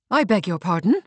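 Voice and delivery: affronted disbelief, lightly acted; startled cry. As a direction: exclaim in mild shock. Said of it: "cried the nurse".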